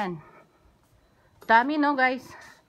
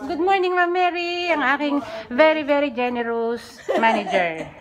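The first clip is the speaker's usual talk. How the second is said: high, loud, beaming hello to the boss; loud cheerful greeting; 5 semitones higher, 5 dB louder than usual. Real, not acted. Vlogger loudly greeting and praising her manager, cheerful.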